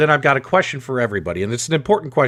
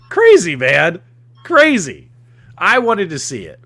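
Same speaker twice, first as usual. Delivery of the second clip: exasperated disbelief; 6 semitones higher, 7 dB louder than usual. Real, not acted. Frustrated at missing the gameplay.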